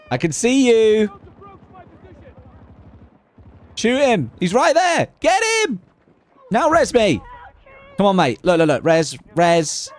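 high frantic pleading shouts; man down shout. Real, not acted. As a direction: yell desperately for a revive. Downed player begging teammates for a revive.

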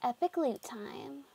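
ordinary delivery

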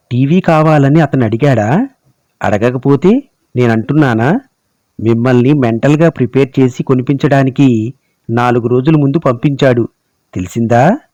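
usual speaking voice

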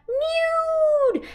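high stretched meow-like voice; mewing cat. Acted. Storyteller mewing as the cat for the children. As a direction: mew it out like a cat.

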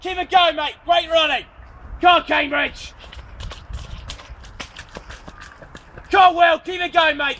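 loud, high shouts of encouragement; urging runners on from the course; cheering shout. Real, not acted. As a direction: bellow support at passing runners.

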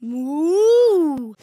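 long moo swelling in the middle; cow mooing. Pretend. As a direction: imitate a cow's drawn-out moo. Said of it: "What sound does a cow make?".

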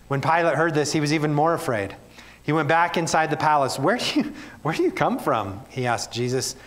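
measured Bible reading to the congregation; earnest public reading of scripture. Acted. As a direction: read the passage with weight and care. Preacher reads scripture passage aloud.

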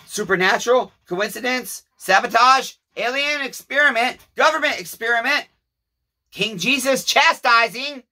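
theatrical questioning, slowing at the end; rising rhetorical questions. Real, not acted. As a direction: fire off each guess with mounting pitch. Rhetorical list of causes.

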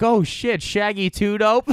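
high mocking heckler yell. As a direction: call it out like a carnival heckler. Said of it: "I remember him being like".

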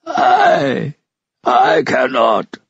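high, drawn-out faltering cry; anguished wail. A narrator's reading. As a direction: wail the refusal from afar, slowly. Hopeless voice answers from the dark.

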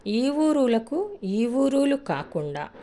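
lent et plus aigu, chaque mot détaché pour qu'on note; slow dictation. Shown as a demonstration. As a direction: dicte ఊరులకు lentement, la voix montée. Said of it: "బాగా గుర్తుపెట్టుకోండి".

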